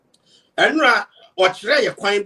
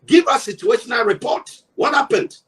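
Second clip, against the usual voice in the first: pitched up, pressing what happened; demanding question; 5 semitones higher than usual. Acted. Commentator to the critic, pressing him for an answer with a raised demand.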